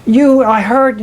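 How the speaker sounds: husband refusing to let go of his wife, distraught; like a squirming baby. Retold or imitated. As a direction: fight it like a baby not wanting to be held. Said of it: "like a baby not wanting to be held".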